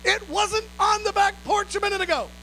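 imitating wife. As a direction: echo a startled wife in a high voice. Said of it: "Amy's like".

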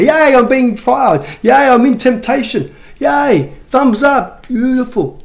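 high-pitched, drawn-out sarcastic yay; mock cheering. Preacher parodies welcoming hardship with joy, mock enthusiasm. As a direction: put on over-the-top delight. Mocking joy at being in trials.

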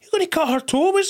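high, astonished outburst acting out a thought; alarmed reaction. Retelling his horror at hooves being cut, alarmed. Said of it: "and I'm thinking".

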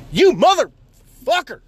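slow, loud, high curse at a driver; road rage yell. Real, not acted. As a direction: spit it at the car ahead. Yelling at driver in traffic.

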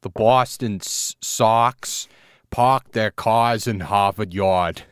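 very slow, deliberate Boston accent. Shown as a demonstration. Host taking his turn at the line, Boston accent. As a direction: draw out each word in a broad Boston accent. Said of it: "uh, let me try".

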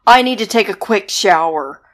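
loud, booming swamp monster; hammed-up monster in the shower. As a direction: bellow like a soggy monster.

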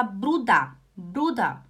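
"Bruder" as if written with final A; correct pronunciation. Shown as a demonstration. Teacher showing the imagined spelling, "Bruder" ending "da". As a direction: clearly say "Bruder" as BRU-da. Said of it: "em, D A".

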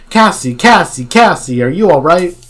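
anxious, insistent calling; worried calling. A narrator's reading. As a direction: call out for a missing friend. Character calls to missing friend.

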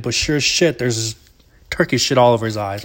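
usual speaking voice

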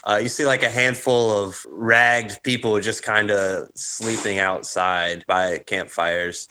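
ordinary talk

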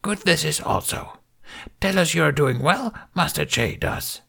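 put-on high, breathy little old master; lightly voiced Yoda. A narrator's reading. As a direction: croak it out like a tiny ancient sage. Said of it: "Yoda nodded".

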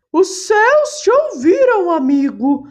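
a bird putting on a holy voice from the leaves; parrot imitating a saint. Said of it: "imitando a voz de santo".

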